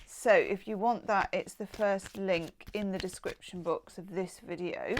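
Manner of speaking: ordinary talk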